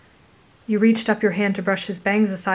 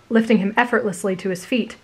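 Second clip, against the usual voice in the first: ordinary delivery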